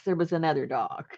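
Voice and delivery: usual speaking voice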